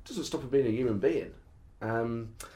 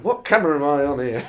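John McDonnell impression; 7 dB louder than usual. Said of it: "that way of talking".

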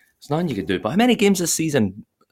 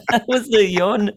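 high, laughing through the words; giggling as he repeats it; 12 semitones higher, 4 dB louder, slower than usual. Real, not acted. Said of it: "Cheers for the yawn there".

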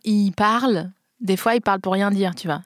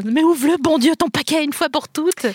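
exasperated shout; 7 semitones higher, 3 dB louder than usual. Retold or imitated. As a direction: yell it, fed up and high. Annoyed people yelling at wrapper noise.

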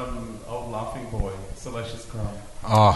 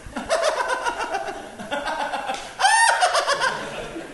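long drawn-out laugh on cue; forced laughing; 5 dB louder than usual. Retold or imitated. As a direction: laugh along alone. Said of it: "just be alone going".

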